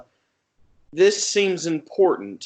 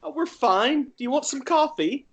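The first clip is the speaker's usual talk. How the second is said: put-on high, sugary greeting to an old friend; Peter's sweet voice; 12 semitones higher than usual. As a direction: chirp it high, all delight. Peter offers coffee.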